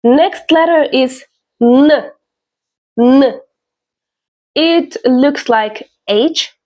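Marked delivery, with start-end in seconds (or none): 1.6-3.4 humming "nuh" for N; N as a sound. Shown as a demonstration. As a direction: say N as in nose, not its name. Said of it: "N, like in nose".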